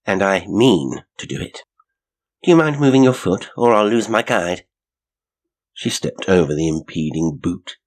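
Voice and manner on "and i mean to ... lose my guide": raised, louder, insistent voice; insistent retort. A narrator's reading. Janet to the man blocking her with his foot, insistent retort. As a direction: press to get past, voice up. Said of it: "she retorted".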